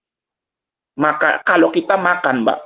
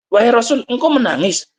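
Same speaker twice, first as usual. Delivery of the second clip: higher-pitched, retold astonishment; mildly surprised question; 6 semitones higher than usual. Companions asking the Prophet why he weeps, mildly surprised. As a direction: voice a companion lightly puzzled, pitch raised.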